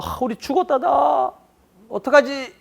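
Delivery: high, panicked mock despair; frightened cry. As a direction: act out the people's sudden fear. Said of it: "두려워했는데". (겁에 질린 목소리로 흉내 내듯)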